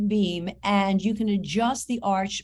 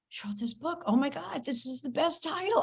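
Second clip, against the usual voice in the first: hushed, rising amazement; acted delighted discovery; 5 semitones higher, 7 dB quieter than usual. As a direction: start under your breath, then climb in pitch. Acting out delighted discovery of book.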